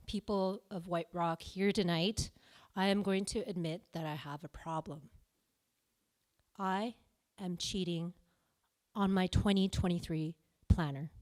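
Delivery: ordinary talk, nothing marked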